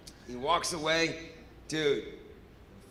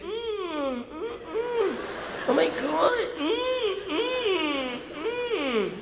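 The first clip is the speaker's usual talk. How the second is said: high, breathy starstruck whimpers; high whimpering moans; 10 semitones higher, much slower than usual. Retold or imitated. Fan seeing Trudeau walk in, whimpering high and starstruck. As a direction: whimper helplessly at the sight. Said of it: "Who the fuck's making this noise?".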